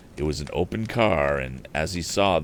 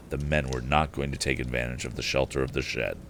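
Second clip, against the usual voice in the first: usual speaking voice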